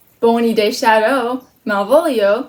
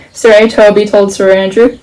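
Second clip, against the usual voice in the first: usual speaking voice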